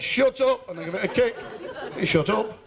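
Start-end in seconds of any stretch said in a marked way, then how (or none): 0.0-0.7 mock shout. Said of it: "I said".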